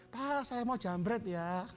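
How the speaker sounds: polite robber. Retold or imitated. Preacher voicing a snatcher politely warning his victim, mock-courteous drawl. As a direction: slowly announce the robbery like asking permission. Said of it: "permisi ya".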